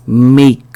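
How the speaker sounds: "make" with "e" gliding into "ee"; "make" said "mmei-k", careful teacher's model; "ei" diphthong. Shown as a demonstration. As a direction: model "make" as "meik", drawn out. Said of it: "이 발음을 해준 겁니다".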